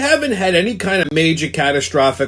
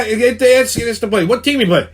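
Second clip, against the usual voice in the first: ordinary delivery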